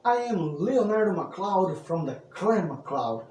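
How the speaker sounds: loud, bright-eyed storyteller welcome; booming minstrel introduction; bardic host greeting. Acted. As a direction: hail the traveler like a tavern bard. Storyteller persona greets the audience.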